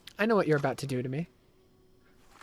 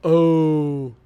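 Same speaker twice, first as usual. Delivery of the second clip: loud drawn-out yells that fade down; startled drawn-out shouts; yelling oh; 14 dB louder, much slower than usual. Real, not acted. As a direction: bellow each oh, less each time. Reacting to a scare in game.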